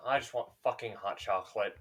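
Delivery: sigh, then low, soft complaint; worn-out muttering to oneself; weary grumble. Acted. Robed man leaving through his portal, wearily grumbling.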